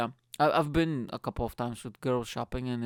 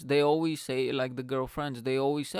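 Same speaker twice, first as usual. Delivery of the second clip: normal talking voice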